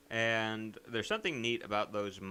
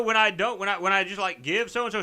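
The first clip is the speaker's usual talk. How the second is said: ordinary talk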